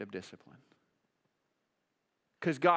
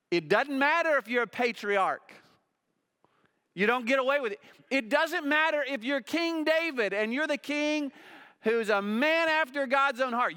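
higher and louder, building each line; preaching at full force; 10 semitones higher, 6 dB louder than usual. Acted. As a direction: thunder it from the pulpit.

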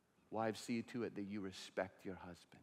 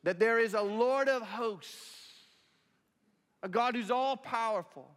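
high, loud preacher's voice voicing Hannah's anger; preaching at full force; 10 semitones higher, 9 dB louder than usual. Pastor preaching to the congregation about Hannah, full force. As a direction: preach it out, raising the voice over the room.

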